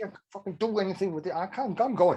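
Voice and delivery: a mock diva outburst, quick and high; storming off in a huff, imitating; retold tantrum. Shirley storms out of the session, mock tantrum. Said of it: "she threw a tantrum".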